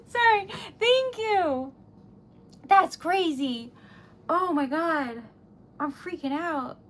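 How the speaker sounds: ordinary talk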